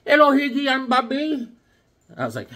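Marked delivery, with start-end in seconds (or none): 0.1-1.5 high put-on buddy voice; Bobby's voice. Voicing his buddy at the gas station, high and chummy. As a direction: do your buddy greeting you, pitched up.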